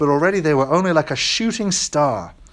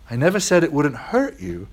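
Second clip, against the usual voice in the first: plain reading voice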